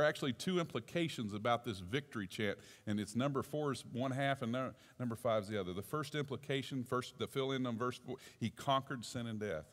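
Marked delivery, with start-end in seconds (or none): none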